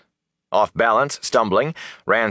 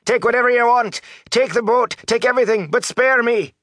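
high, frantic begging; panicked high-pitched cries; terrified pleading. A narrator's reading. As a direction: babble out a desperate plea for mercy. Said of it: "he cried".

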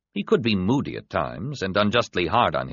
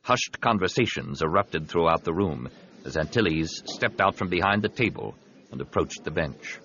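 ordinary delivery, nothing marked

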